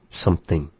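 ordinary talk

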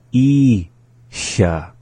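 ISHA split, long I, pause, hissed SHA; slowly. Shown as a demonstration. Teacher repeating the word for learners, slowly. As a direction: break ISHA into two slow syllables. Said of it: "the second time slowly".

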